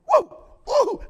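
high, loud "woo" of joy; rejoicing whoop. Acted. Preacher showing how the forgiven rejoice, joyful whoop. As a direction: whoop it up like church praise.

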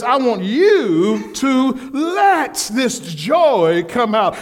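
slow, drawn-out shouted command; God's command. Acted. Preacher voicing God calling the church to joy, slowly shouted. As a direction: stretch every word and proclaim it.

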